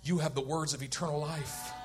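hushed, low plea; softly and humbly, as if praying; broken heart. Acted. The broken heart asking the Lord, hushed and low. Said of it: "But the broken heart says".

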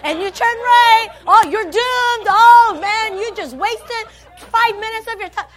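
loud, high-pitched exasperated scolding; hammed-up outraged tirade; Google Map with attitude. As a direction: shout it like a fed-up navigator blaming the driver. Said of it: "Google Map had an attitude like yours".

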